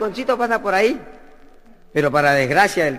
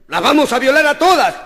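high, louder mock threat; robbers' threat; 7 semitones higher, 5 dB louder than usual. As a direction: bark it like a burglar. Robbers kicking in the door. (amenaza burlesca y fuerte)

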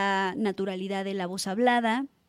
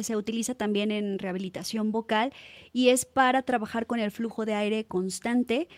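ordinary delivery

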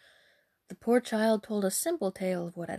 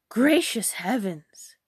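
loud, drawn-out shocked exclamation; slow, loud dismay; horrified cry; 6 dB louder, slower than usual. Acted. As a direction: cry out aghast, slowly. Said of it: "cried her mother".

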